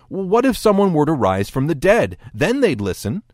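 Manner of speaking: mildly acted desperation; desperate. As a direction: make a last, desperate appeal. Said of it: "Desperate, the man suggests".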